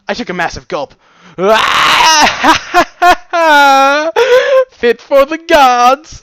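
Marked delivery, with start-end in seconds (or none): 1.3-4.7 long, loud high cackling cry; exuberant yell. Acted. Character after a massive gulp of cider, exuberant yell. 4.7-6.2 slow, high, booming cheer; exultant shout. Acted. Character praising the cider after a gulp, exultant shout.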